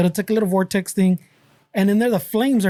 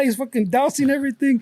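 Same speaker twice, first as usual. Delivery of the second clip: normal talking voice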